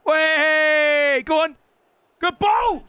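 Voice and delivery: high, loud urging; excited shout. Real, not acted. Gamer cheering his own attack, excited shout. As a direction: yell the attack forward.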